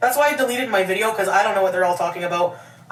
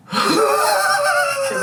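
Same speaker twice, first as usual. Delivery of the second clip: high, slow squeal through laughter; laughing squeal. Real, not acted. Friend teasing about Rosie's anger, slow laughing squeal. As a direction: tease them, cracking up.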